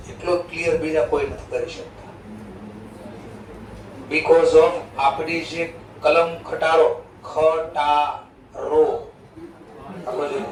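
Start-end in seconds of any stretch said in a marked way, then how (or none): 4.1-8.9 slow, crisp ખટારો with every sound clear; slow tongue drill. Shown as a demonstration. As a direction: roll out ખટારો syllable by syllable, very slow. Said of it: "આખી જ જીભ તમારી આ રીતે pronounce કરે". (ખટારો ધીમે, દરેક અક્ષર સ્પષ્ટ)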